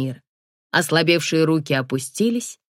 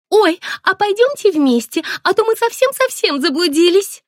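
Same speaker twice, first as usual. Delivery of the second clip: put-on high, plaintive girlish chirp; 13 semitones higher, 4 dB louder than usual. A narrator's reading. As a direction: chirp it helplessly like a coy schoolgirl. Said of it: "Жалобно защебетала та". (жалобное щебетание)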